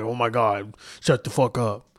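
ordinary talk

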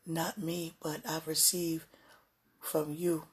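usual speaking voice

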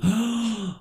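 sharp, high delighted gasp; excited gasp. Real, not acted. Excited at Pride Parade idea.

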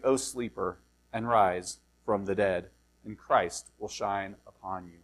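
low, measured Bible reading; solemn reading aloud, falling at phrase ends; scripture reading. Acted. Reads the scripture passage aloud.

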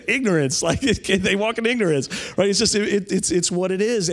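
a laugh in the voice; laughing while talking. Real, not acted. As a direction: still amused as he makes the point. Laughing through after the story.

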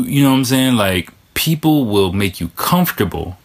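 ordinary talk

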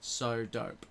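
low, quiet Australian-sounding drawn vowels; Australian accent. Shown as a demonstration. Host showing a two-word party trick, quiet Australian accent. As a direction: lightly put on an Australian accent. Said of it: "sometimes I sound Australian".

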